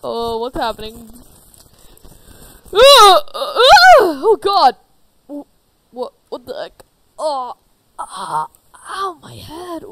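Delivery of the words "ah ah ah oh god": high, loud waking screams; startled screams. Acted. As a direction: scream awake in shock. Characters wake in pain.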